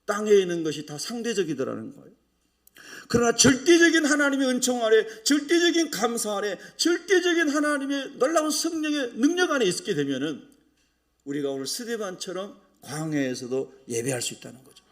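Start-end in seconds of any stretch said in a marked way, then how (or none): 3.1-10.5 high, insistent sermon delivery; impassioned pulpit emphasis; forceful preaching. Real, not acted. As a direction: press each phrase on the congregation.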